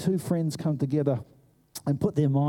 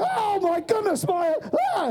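put-on fussing old lady. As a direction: gasp it like a startled elderly lady. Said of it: "there's this lady going".

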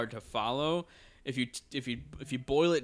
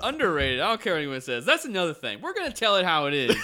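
loud, raised, defiant proclamation; emphatic declaration; 6 semitones higher, 8 dB louder, faster than usual. Real, not acted. Hosts defending an underrated series, loud emphatic declaration. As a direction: proclaim it loudly, breaking into laughter.

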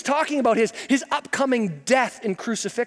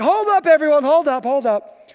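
calling a halt; 5 semitones higher, 5 dB louder than usual. Acted. Jesus halts the fight.

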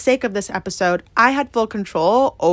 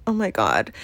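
muttered dismay; 12 semitones lower, 5 dB quieter than usual. Retold or imitated. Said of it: "And I was just like".

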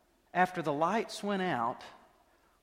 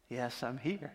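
God answering softly; 6 semitones lower, 8 dB quieter than usual. Retold or imitated. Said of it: "he replies".

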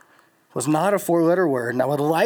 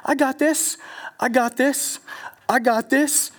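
cocky self-assurance; 7 semitones higher, slower than usual. Retold or imitated. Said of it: "and it's like".

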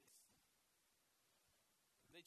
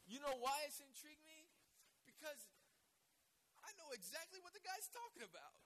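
excited; 9 semitones higher, 5 dB louder than usual. Real, not acted. Preacher confessing with excitement.

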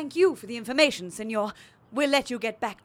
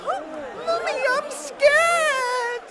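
put-on high, loud frightened little child; scared child voice; 7 dB louder, much slower than usual. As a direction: squeal it like a scared toddler. Grown adult cries for mummy.

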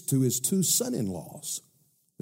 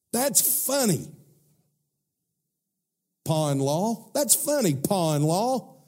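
high, laughing scornful voice; mocking sons-in-law; 9 semitones higher than usual. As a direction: sneer and laugh at the old man.

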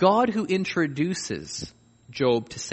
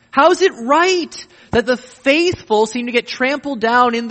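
much higher and louder, pleading outrage; indignant lament; 10 semitones higher, 6 dB louder than usual. Acted. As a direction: cry out at the injustice to God. Psalmist's bitter complaint acted.

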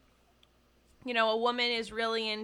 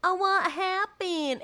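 mock clueless; 6 semitones higher, slower than usual. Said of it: "she doesn't have to be like".

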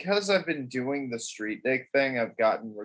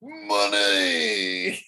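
announcer money; 4 semitones lower, 3 dB louder than usual. Shown as a demonstration. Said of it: "you gotta emphasize it".

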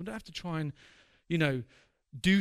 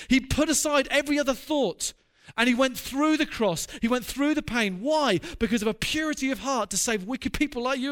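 louder, higher, impassioned preaching build; preaching at full force; 5 semitones higher, 4 dB louder than usual. Acted. Preacher ends his sermon on the cross, loud and impassioned. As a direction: drive home the cross with fervour.

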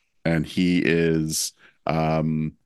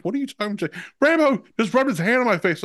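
exasperated Will; 13 semitones higher, much faster than usual. Retold or imitated. Friend reacting to a face caress, high exasperated outburst. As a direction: squeal out a baffled complaint. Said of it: "he's like".

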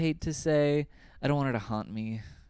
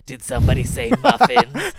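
put-on much higher and louder, croaky old hag voice; hag grandma; 10 semitones higher, 11 dB louder than usual. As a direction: cackle it out like a gleeful old crone. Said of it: "hag grandma".

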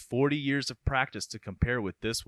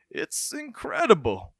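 amazed doctor; 9 semitones higher than usual. Acted. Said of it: "I'm leaning towards the doctor".

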